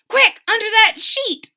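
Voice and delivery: high, loud urgent call; urgent shout. A narrator's reading. Charles urges Wilbur to hide from the dog, shouting. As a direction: rush him to hide. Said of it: "shouted the four bunnies".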